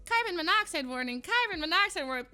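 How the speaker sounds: high, repeated automated warning; imitated alarm voice. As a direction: mimic a detector's insistent alert. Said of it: "It goes".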